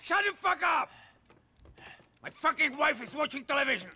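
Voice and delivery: high, loud angry yell; furious shout. Acted. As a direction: boss shouting at the men in his basement. Boss yells at noisy men.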